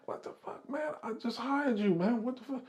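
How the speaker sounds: high, hushed incredulous protest; retold bewildered squeak; mock disbelief. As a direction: act stunned in a small high voice. Retold shocked reply to a challenge.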